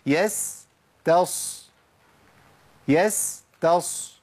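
يستص very slow, held on س then ص; يستص stretched to feel the tongue move; slow drill. Shown as a demonstration. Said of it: "جرب كده وشوف لسانك". (يستص ببطء شديد)

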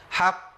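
حق with the qaf lengthened like two; extended qaf. Shown as a demonstration. Teacher showing the longer qaf. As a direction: stretch the qaf of حق. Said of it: "بدي زمن زياده شويه".

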